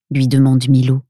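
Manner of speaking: usual speaking voice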